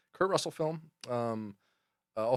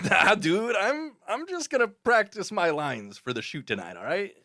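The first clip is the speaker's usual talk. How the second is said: higher, louder, brash New York street voice; hyped, loud dude voice, pitched up; John Leguizamo impression; 7 semitones higher, 4 dB louder than usual. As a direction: scoff at the director like a cocky young actor. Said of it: "John Leguizamo kinda talks like this".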